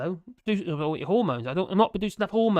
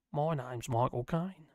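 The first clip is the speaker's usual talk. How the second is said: Michael Caine impression; 5 semitones lower, 6 dB quieter, slower than usual. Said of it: "Not a lot of people know that".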